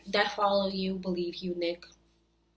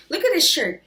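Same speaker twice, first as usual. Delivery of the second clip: raised, louder angry outburst; heated jab at the shirt; 7 semitones higher, 7 dB louder than usual. Real, not acted. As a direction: snap at him in disgust. Angry rant at Nick's crying shirt.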